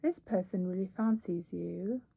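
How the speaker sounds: cute mock British accent, lightly done. Shown as a demonstration. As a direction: say it like a playful Brit. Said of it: "I should say it in a British accent".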